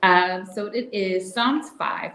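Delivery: usual speaking voice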